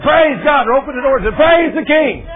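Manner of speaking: high, loud shout of praise; joyful praise. Retold or imitated. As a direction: cry out praising God. Imagined joyful response.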